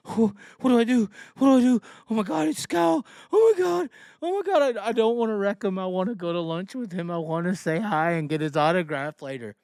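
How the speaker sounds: high, gushing mock fangirl voice; mock starstruck fan. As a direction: squeal like a starstruck fan meeting his idol. Mocking starstruck fans.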